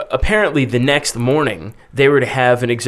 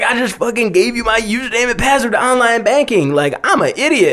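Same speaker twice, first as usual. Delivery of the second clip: high-pitched retold disbelief; incredulous; 10 semitones higher than usual. Man realizing he gave up his password, incredulous. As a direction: imitate someone aghast at their own blunder.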